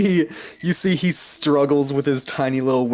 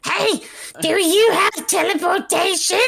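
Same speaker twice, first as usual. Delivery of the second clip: high excited imp voice. Acted. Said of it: "He's like".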